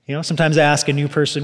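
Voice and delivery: ordinary talk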